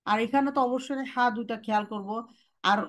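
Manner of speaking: usual speaking voice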